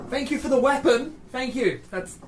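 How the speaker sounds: high, loud acted call; yelling to him. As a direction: call it out across a fight. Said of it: "I'm gonna yell to him".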